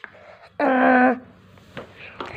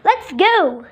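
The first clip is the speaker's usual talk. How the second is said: usual speaking voice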